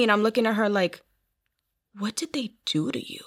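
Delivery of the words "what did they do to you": soft, breath-only disbelief; retold stunned whisper. Speaker looking at her glowing sister, retold stunned whisper. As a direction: whisper it as if astonished.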